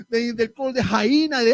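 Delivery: ordinary delivery, nothing marked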